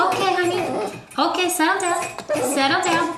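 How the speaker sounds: high, gentle settle down; soothing coo. Real, not acted. Calming the puppy before letting him out, high soothing coo.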